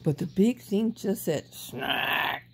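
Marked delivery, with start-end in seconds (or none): 1.5-2.4 snark. A narrator's reading. Machine noise in story.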